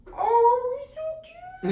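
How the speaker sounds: squeaky doting coo; cooing baby talk. Retold or imitated. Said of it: "the guy's just like".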